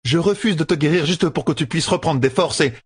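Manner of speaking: high, insistent woman's voice; scolding sister. A narrator's reading.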